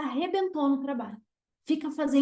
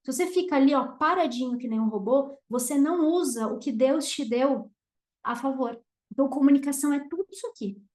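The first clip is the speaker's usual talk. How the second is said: ordinary talk, nothing marked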